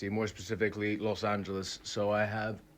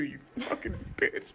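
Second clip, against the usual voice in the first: mock insult; 13 semitones higher than usual. Real, not acted. Insult capping rant about Luxembourg.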